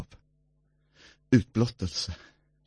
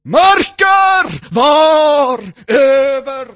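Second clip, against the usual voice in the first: higher, slow shouted phrase; preaching shout; 11 semitones higher, 6 dB louder, slower than usual. Acted. Preacher shouting about darkness over the Son.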